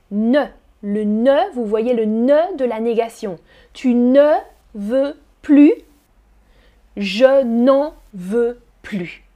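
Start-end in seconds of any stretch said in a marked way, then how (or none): 3.7-9.2 "Je n'en veux plus" said slowly and clearly, "plus" as "ply"; slow demo. Shown as a demonstration. Teacher slows down the negative sentence. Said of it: "mes deux phrases sont négatives".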